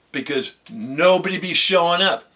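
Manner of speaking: normal talking voice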